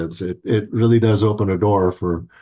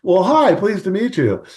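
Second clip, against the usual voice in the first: higher, tumbling, flustered greeting; flustered surprise; 8 semitones higher than usual. Retold or imitated. Greeting the daughter he just discovered, higher flustered surprise. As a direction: stammer out a stunned hello. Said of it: "I said".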